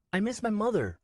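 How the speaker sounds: put-on high boy's voice, begging; pleading child. Nico begging Swen to turn back, high pleading child. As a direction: beg him to take you home.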